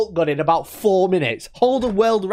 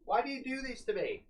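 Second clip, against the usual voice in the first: soft, worked-up whine; quiet hammed-up exasperation; mock anger; 12 dB quieter than usual. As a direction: complain helplessly, keeping the volume down. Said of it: "I'm so angry".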